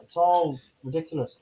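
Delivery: ordinary talk, nothing marked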